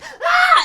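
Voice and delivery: piercing high scream with a laugh; frightened shriek. Retold or imitated. Woman scared by the homeless woman, shrieking. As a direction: let out a shrill scared yelp. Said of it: "I was like".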